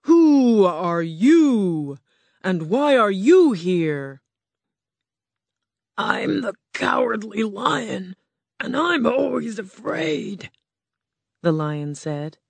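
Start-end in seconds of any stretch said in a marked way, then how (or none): 0.1-4.2 slow, booming beast voice of Oz; grand slow roar from a huge animal. Acted. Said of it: "the ball of fire roared". 6.0-10.5 lightly voiced timid lion; a hint of a nervous big cat; cowardly lion. Lion speaks to Oz.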